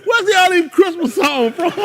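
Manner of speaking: loud, high-pitched comic complaint; exasperated high voice, laughing through it; high incredulous protest. Acted. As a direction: squeal the question in mock disbelief. Incredulous man questions carol singer.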